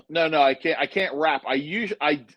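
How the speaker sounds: usual speaking voice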